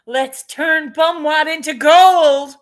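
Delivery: high, loud, slow gloating villain; hammed-up scheming glee; greedy pandemic voice. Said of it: "this pandemic says".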